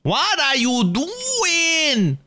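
very high, stretched-out wail; drawn-out whine. Real, not acted. Streamer pestering the partner off to the side, high drawn-out whine. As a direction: drag it out high and exasperated. Same question repeated to partner.

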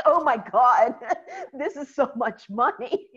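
laughing shock. Retold or imitated.

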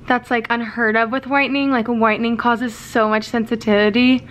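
speaking with lips stretched; lips pulled by fingers. Real, not acted. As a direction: fingers holding mouth open. Seen 3: Fingers stretch lips apart to show teeth while speaking.

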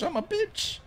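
very high, small squeaky whine; self-mocking squeal. Real, not acted. Streamer berating himself for a bad swing, squeaky self-mockery. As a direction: squeak it out in mock self-pity.

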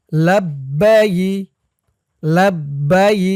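লাব্বাই said slowly with a clear doubled b and long ai; careful pronunciation. Shown as a demonstration. Teacher showing students how to say লাব্বাই, slow and clear. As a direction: spell out লাব্বাই, stretching the ই sound. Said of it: "যেমন উচ্চারণ করব, আপনারা খেয়াল করে শুনবেন".

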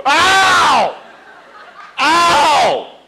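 a high, loud cry of pain; loud yelp of pain. Acted. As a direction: yelp like your shoulder just popped. Kid slammed in Red Rover.

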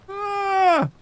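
high startled scream; sudden shriek of panic; panicked scream. Real, not acted. Panic while chased.

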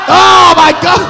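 high, startled exclamation; shocked outcry. Real, not acted. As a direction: blurt it out in shock. Shocked reaction to the attack.

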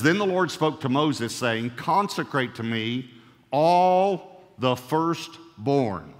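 slow, emphatic scripture read; preacher reading God's words with weight. Acted.

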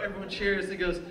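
ordinary delivery

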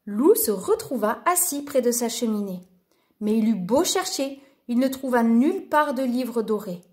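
usual speaking voice

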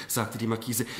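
usual speaking voice

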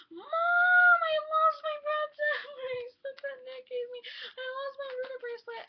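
retold high, loud wailing, half-laughing; crying to mom. Girl running to her mom over a lost bracelet, wailing. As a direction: wail it like a kid who lost her treasure. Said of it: "ran up to my mom crying".

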